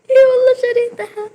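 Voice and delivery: very high, loud and fast squealing, near tears; real ecstatic shriek. Real, not acted. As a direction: shriek it out with joy. Repeated with louder delivery.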